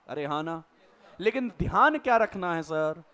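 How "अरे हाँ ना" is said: students' reply. Pretend. Teacher voices students' reply.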